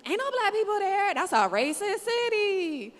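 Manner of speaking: high, loud mock-shocked outsider voice. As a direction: play the clueless outsider, aghast. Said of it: "people who don't live here are like".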